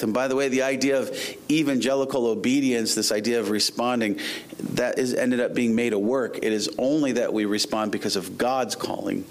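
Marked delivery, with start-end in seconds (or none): none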